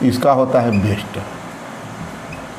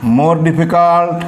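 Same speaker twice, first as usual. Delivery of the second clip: slow drill; much slower than usual. Shown as a demonstration. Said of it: "teacher drills the comparative form".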